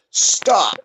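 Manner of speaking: urgent high-pitched mock yell; retold yell. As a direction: yell it as a sudden halt, without full volume. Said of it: "And I yelled".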